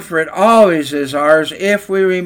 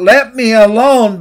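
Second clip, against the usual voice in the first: loud, higher cry to be left alone; yelled plea; 5 semitones higher, 6 dB louder than usual. Acted. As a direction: yell it out like clay being rolled. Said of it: "and I yelled out".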